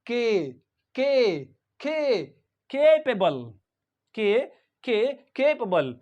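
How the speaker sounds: capable built from a long "KAY"; K held alone, then capable; first syllable demo. Shown as a demonstration. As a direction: stress the KAY in capable, drop away after. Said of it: "first syllable we pronounce is".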